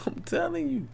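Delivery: whispered laugh. Real, not acted. Laughing aside after a point.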